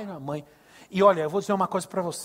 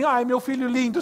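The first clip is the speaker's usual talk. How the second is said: doting mother; 5 semitones higher, 5 dB louder than usual. Retold or imitated. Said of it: "quando sua mãe diz assim".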